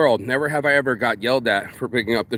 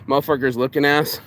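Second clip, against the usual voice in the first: normal talking voice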